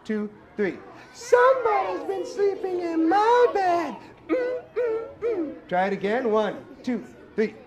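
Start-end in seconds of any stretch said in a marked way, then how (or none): 1.1-3.9 sassy mama bear voice. Shown as a demonstration. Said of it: "let's try that all together". 4.3-5.6 high sung mm mm mm; sassy mama bear hum. Shown as a demonstration. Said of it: "can you go for me".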